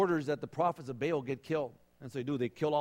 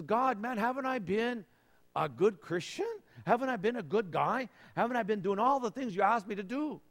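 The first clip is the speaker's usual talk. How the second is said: high, complaining mock whine; whiny self-pity; 6 semitones higher than usual. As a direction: play the hurt good boy pleading. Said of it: "Kind of a little pity party with God".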